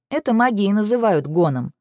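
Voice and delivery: usual speaking voice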